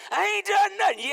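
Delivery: loud, high defensive protest; heated denial. Acted. A churchgoer shouting back at a meddling friend. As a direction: fire back like you're falsely accused. Acted quarrel between two friends.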